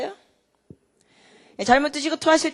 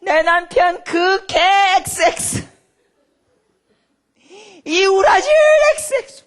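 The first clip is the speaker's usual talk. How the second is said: slow, high, louder cursing cry; furious prayer; 9 semitones higher, 12 dB louder, slower than usual. Retold or imitated. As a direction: rage at the husband before God. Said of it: "흥분하셔가지고 기도하는데". (남편에게 분노하며 울부짖는 기도)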